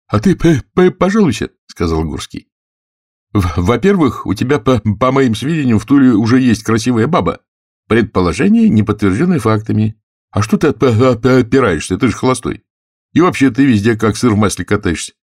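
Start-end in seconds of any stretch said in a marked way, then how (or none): 0.1-1.5 high, loud stutter on the P; stumble, then throw the word out high; stuttering character's raised-voice jibe; stuttering retort. Acted. Stuttering Gursky voiced in dialogue. 3.3-15.1 high-pitched stutterer needling a friend; teasing, stuttered argument in raised voice; stuttering Gursky. Acted.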